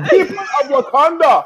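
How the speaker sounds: high, calling mock-heroic cry; hammed-up proclamation. Host performs the salute for co-host, mock-heroic call. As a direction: proclaim it to a nation, half laughing. Announced performance with arms crossed.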